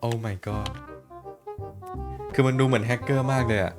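ordinary talk

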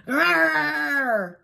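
little fox's growl; high-pitched drawn-out animal growl. A narrator's reading. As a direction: growl to scare off an eagle. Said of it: "Little Fox growled".

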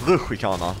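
"Rutschkana" said as ruschi-kana, with an i inside; trying rutschkana variants. Shown as a demonstration. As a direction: try "Rutschkana" with an extra i after sch. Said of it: "Rolig dialekt".

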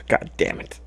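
breathy whispered frustration; whispered curse. Real, not acted. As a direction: hiss it under your breath. Frustrated after getting hit.

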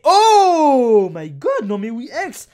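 loud, long-drawn exclamation of amazement; amazed shout. Real, not acted. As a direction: stretch it out in a real excited yell. Shock at another rare card.

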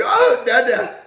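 mock crying baby calling dad; whiny baby. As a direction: whine it like a choking baby. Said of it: "Like a little ass baby".

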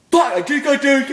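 high, loud squeal. Real, not acted. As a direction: squeak it out suddenly. Sudden squeal mid-sentence.

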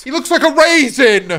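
high, loud exclamation; outraged outburst. Real, not acted. Streamer reacting to a picture, outraged shout.

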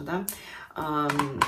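ordinary talk, nothing marked

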